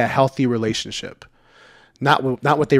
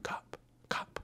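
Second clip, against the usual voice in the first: urgent unvoiced whisper of cop, cop; frantic whispered alarm in a parked car; 23 dB quieter than usual. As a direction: hiss the warning under your breath like a scared mom. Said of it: "She's all whispering".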